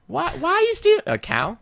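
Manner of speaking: high incredulous mom. Retold or imitated. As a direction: squeak it like an outraged mom. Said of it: "your mom's gonna be like".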